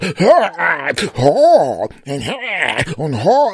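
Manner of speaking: high, hammed-up straining cries; straining groans. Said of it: "Why are you making all those noises?".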